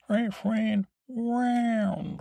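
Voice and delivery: high squeaky cat voice; cat character voice. Pretend. Sir Pounce character speaks.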